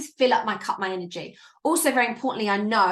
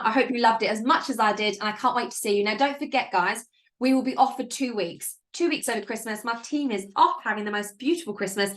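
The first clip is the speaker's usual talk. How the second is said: normal talking voice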